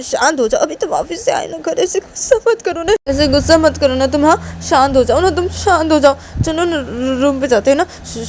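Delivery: insistent coaxing building to urgent pleas; desperate pleading. Acted. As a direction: beg them to stay and calm down. Uma clings and begs him.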